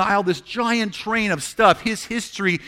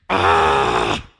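a short, low, loud cry of frustration; frustrated shout; 6 dB louder than usual. Pretend. Pastor voicing an angry prayer, with a loud frustrated cry. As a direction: let out a frustrated roar. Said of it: "I'm a lot louder than that in person".

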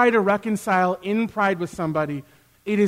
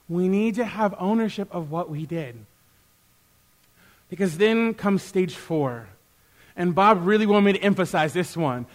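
usual speaking voice

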